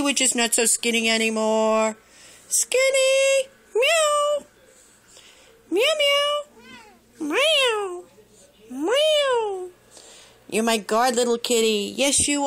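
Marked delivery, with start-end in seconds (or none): 2.5-3.5 squealing the cat's name up high; high pet-name call. Real, not acted. Calling the cat by name. 3.7-9.7 high playful cat meows; high, light mock meows; meowing like a cat. Meowing at the cats.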